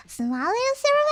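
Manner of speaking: high squeaky voice picking the syrup; squeaky kid reply. Retold or imitated. Child answering the parent, high and squeaky. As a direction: pipe it up like a small kid. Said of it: "I go".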